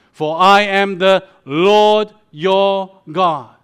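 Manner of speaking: "Lord your God" loud, each word drawn out and set apart; slow, weighty stress on "Lord", "your", "God"; emphasis on Lord God. Acted. Preacher quotes God's command to Israel, "Lord your God" slowly and loudly emphasised. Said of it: "that emphasis on the word".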